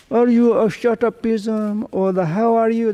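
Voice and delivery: put-on high, slurred old-person voice; old person talking. Old monk mimics elderly speech, high-pitched old voice. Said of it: "some old, okay, it's the same as, like, me".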